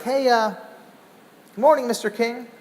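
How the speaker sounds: quiet, careful slow hello; gentle greeting. Retold or imitated. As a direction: ease into it gently. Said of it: "be a bit more gentle".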